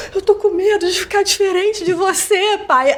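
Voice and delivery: voz chorosa e alta, quase soluçando; crying. Retold or imitated. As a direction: fala chorando com medo. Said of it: "e chorando". (filha chorando ao telefone para o pai)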